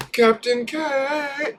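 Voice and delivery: very high and slow call of Captain K; cartoon falsetto shout; falsetto name call. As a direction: sing the name out high and stretched.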